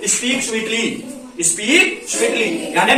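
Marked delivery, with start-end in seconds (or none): 1.5-2.5 very slow, every word stretched; slow, deliberate repeat. Shown as a demonstration. Teacher repeating the English phrase very slowly for students. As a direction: spell it out slowly for the class. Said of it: "मीठा बोलो".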